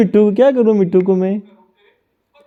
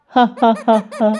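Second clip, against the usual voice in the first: higher, evenly beaten 'ha ha'; mock laugh; 3 semitones higher, much slower than usual. Shown as a demonstration. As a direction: show a parrot how to laugh. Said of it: "कैसे हंसते हो मिट्टू?". (हंसने की नकली आवाज़, हा हा)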